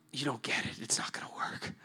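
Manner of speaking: soft, fading to a near whisper; defeated refusal. Acted. Struggling man to his friends, soft defeated murmur. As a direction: give up under your breath. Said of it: "And then I just say".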